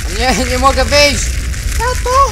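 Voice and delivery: high desperate cry for help; panicked scream. Acted. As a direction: shriek for dad in panic. Trapped in burning car, calls father.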